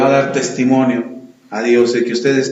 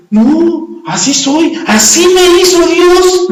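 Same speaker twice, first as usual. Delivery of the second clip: much higher, slow whining protest; whiny excuse; 13 semitones higher, 6 dB louder, much slower than usual. Acted. Stubborn believer whining back at the Holy Spirit, high and slow. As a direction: whine like a stubborn child.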